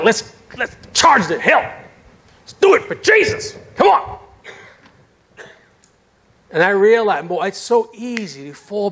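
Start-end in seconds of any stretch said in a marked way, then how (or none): 0.0-4.2 high rallying push to charge; rallying cry. Retold or imitated. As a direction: fire up the worn-out team. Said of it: "And I'm like".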